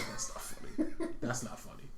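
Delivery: hushed protest with laughter leaking out; stifled laughter. Retold or imitated. Telling themselves the slap is not funny, stifled laughter. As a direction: insist softly through a suppressed laugh. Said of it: "I'm trying not to laugh".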